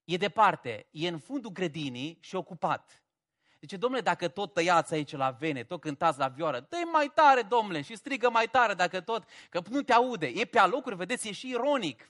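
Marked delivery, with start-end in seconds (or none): 3.8-10.3 mocking taunt. Elijah mocking Baal's prophets, loud sarcastic jeering. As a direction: egg them on with mock encouragement. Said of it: "face bășcălie de ei".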